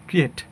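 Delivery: ক্রিয়েট with a short final e; common mispronunciation. Shown as a demonstration. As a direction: say ক্রিয়েট as "kriet", no glide. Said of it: "এটাকে আমরা বলি".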